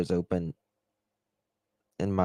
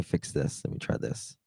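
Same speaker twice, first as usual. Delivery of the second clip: whispered aside; 5 semitones lower, 8 dB quieter than usual. Real, not acted. Fixing microphone, aside.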